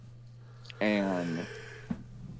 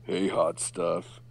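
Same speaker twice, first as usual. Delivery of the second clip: put-on slow, lower leering old-man line; lightly voiced leering old man; old dudes catcalling; 4 semitones lower, slower than usual. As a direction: drawl it like a creepy old guy. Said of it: "old dudes were like".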